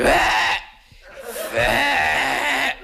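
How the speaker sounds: high startled shriek; aunt's shocked screech. Retold or imitated. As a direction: shriek like a seagull.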